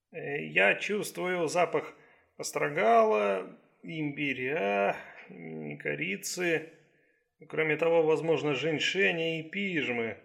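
slow, deliberate doctor's voice; Chinese doctor, pondering. Acted. Doctor naming herbs by their smell, slow and pondering. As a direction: draw out each item as you identify it.

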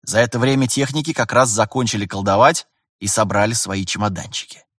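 plain reading voice

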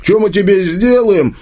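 high-pitched, heated demand; angry repeated threat. A narrator's reading. Tolik attacks Arsen and repeats his threat, angrily.

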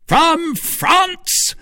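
very high exclamation; high, amazed outburst; startled cry. A narrator's reading. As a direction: cry out in astonishment. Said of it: "cried Buckingham".

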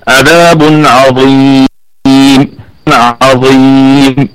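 slow عذاب عظيم, the ي of عظيم held; joined recitation. Shown as a demonstration. Teacher shows joining عذاب عظيم, slow recitation. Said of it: "فوصلًا نقول".

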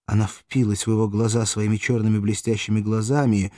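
plain reading voice

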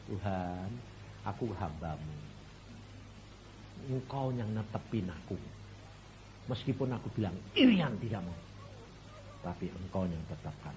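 low, soft humble prayer. Retold or imitated. Young doctor praying before meeting the director, low soft prayer. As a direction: pray it quietly and lowly.

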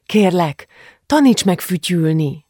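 lightly voiced child begging dad; slightly panting child. A narrator's reading. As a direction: plead like an eager child. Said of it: "szólította meg Julcsi kissé lihegve".

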